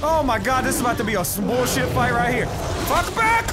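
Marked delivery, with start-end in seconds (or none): 2.9-3.5 high-pitched combat yell; frantic yell. Real, not acted. Gamer fending off attackers, frantic yell. Fighting off enemies in game.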